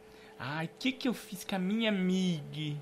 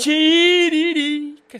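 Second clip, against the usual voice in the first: drawn-out shout; 9 semitones higher, 12 dB louder, much slower than usual. Acted. Host calls out a name.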